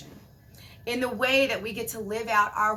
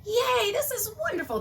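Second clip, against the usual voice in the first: much higher, bright little cheer; mock cheer; 12 semitones higher than usual. Cheering when miracles come fast. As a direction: squeal it like a happy surprise. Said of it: "things are like".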